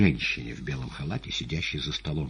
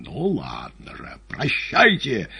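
cornered defiance; 4 semitones higher, 5 dB louder, slower than usual. Acted. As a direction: drawl the threat, then fling the last word. Cornered patient threatens, then leaps.

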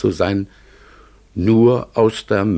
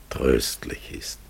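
slow soft whisper; 4 semitones lower, 5 dB quieter, slower than usual. Acted. Raven confiding a comforting thought, whispered.

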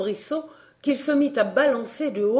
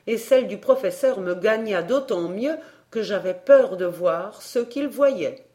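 plain reading voice

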